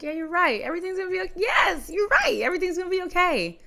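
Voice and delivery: echoing his brother's comfort, rising to loud excitement; building excitement. Retold or imitated. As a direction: build from agreeing to near shouting joy.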